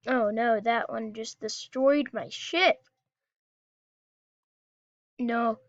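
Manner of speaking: usual speaking voice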